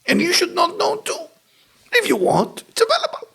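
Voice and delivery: high, light teasing voice; playful reassurance. Retold or imitated. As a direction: coax her lightly. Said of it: "I say".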